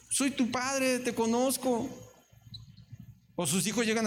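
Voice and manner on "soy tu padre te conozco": más agudo, tono de padre que sabe todo; un padre divertido que tranquiliza; Dios paternal. Pretend. Dios habla al presumido. (Dios le responde al presumido, tono paternal y agudo)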